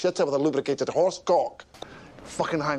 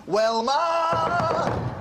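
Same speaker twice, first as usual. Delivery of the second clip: high, drawn-out yell; name called out at high pitch; 10 semitones higher than usual. Acted. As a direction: bellow the name mockingly. Calling out mid-tirade.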